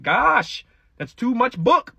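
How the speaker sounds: high-pitched exasperated self-quote; exasperated exclamation. Retold or imitated. Reader fed up with months on a book, exasperated. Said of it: "I'm like".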